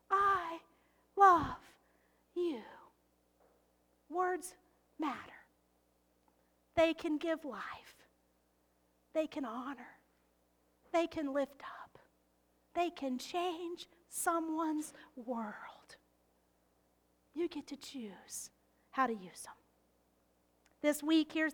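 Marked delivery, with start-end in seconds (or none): 0.1-2.6 I, love, you set apart with pauses; slow spaced I love you. Shown as a demonstration. 4.1-19.6 softer and softer into a whisper; hushed whisper. Real, not acted. Sermon climax falls to whisper.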